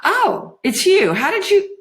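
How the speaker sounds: put-on high, surprised girl's voice; high-pitched, cut short by surprise; startled. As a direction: burst out in startled recognition. Juliet startled by sudden appearance.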